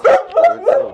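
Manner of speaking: high, loud dog praise; excited praise. Real, not acted.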